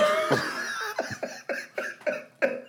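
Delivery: high squealing laugh that won't stop; helpless laughing fit. Real, not acted. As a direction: crack up completely, breathless. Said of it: "Let me compose myself".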